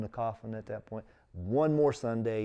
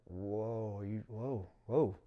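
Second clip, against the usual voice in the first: taken aback; 7 dB quieter, much slower than usual. Retold or imitated. Bishop taken aback by the crying.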